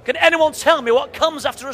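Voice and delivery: teacher voice. Retold or imitated. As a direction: address the whole class like a primary teacher. Teacher addressing class.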